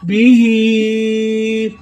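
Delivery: बी ही with the ही vowel held long and slow; बी ही, the final ई stretched very slowly; four-count stretch. Shown as a demonstration. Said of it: "इसको चार हरकत तक खींच कर पढ़ते हैं".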